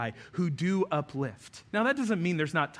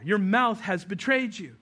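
ordinary talk, nothing marked

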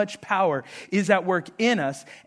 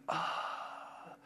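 long, voiceless breathed-out sigh; imitated sigh; 19 dB quieter than usual. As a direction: let out a soft, drawn-out sigh. Imagined unbothered Elisha reacts to servant.

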